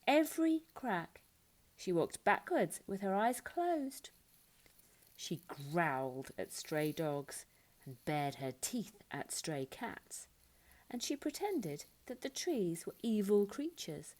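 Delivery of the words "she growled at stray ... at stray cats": growly narration. A narrator's reading. Narrating growling, baring teeth.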